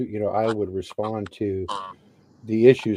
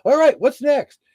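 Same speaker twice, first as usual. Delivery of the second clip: high-pitched, pepped-up reenactment; feeling great; 11 semitones higher, 3 dB louder than usual. Retold or imitated. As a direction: act out waking up on top of the world. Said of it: "I felt like a million bucks".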